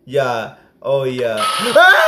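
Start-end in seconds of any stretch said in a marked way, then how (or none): 1.4-2.1 short high yelp of surprise; startled yelp. Real, not acted. Gamer spooked in a dark room, high yelp. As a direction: yelp as something appears.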